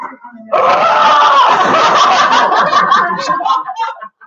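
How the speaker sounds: big high burst of laughter; loud laugh. Real, not acted. Opening lefty gift.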